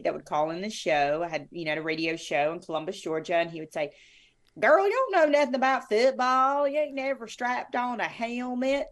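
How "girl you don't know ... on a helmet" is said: louder, higher Southern caller voice, mocking; Southern football fan. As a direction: play the brash Southern radio caller. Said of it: "you do the Southern football fan very well".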